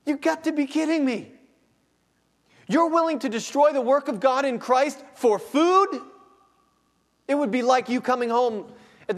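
can't believe what you're hearing; incredulous outburst. Acted. Pastor mocking trading God's work for food, incredulous.

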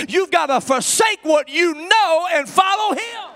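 loud, high-pitched preacher's exhortation; full-force preaching. Acted.

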